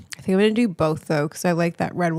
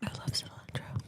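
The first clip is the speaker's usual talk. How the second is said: soft unvoiced whisper; 16 dB quieter than usual. Real, not acted. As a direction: breathe it out quietly. Whispering while eating.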